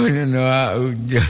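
normal talking voice

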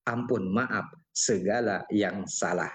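unhurried Malay poetic delivery; pantun recitation. Shown as a demonstration. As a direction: recite the verse with feeling, pausing between phrases. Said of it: "itu kan contoh kita bawakanlah pantun".

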